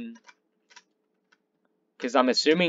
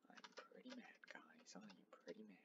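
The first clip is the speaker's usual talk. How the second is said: whispered mock anger; 2 semitones lower, 34 dB quieter than usual. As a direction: hiss your anger under the breath.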